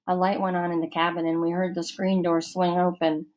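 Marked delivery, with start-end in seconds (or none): none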